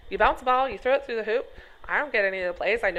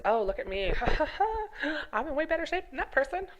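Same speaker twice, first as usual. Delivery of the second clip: higher, laughing smug imitation; mock smug voice; 7 semitones higher than usual. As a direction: sneer like someone gloating at others. Mocks a smug gym-goer.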